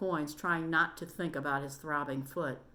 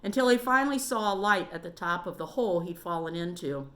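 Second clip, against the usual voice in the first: plain reading voice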